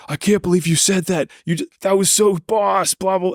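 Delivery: high, excited mock friend voice; imitating amused friend. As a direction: squeak it out like a delighted buddy. Friend laughing, retelling the reaction.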